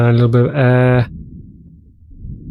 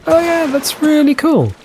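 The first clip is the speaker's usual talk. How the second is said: impressed reaction; 13 semitones higher than usual. Retold or imitated. Gamer recalling seeing it in the edit, impressed. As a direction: quote yourself being impressed, pitched right up.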